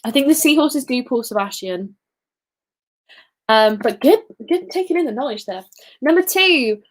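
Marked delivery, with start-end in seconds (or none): none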